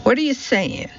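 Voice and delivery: high-pitched, confrontational question; abrasive northern challenge. A narrator's reading. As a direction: bristle at the remark, pitch jumping up. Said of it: "the abrasive northern tone".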